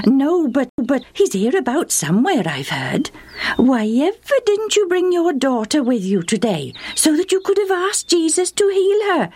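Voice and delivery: high-pitched, animated acquaintance; chatty neighbour woman. A narrator's reading. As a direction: gossip eagerly in a high storybook voice. Voices the woman Justa meets.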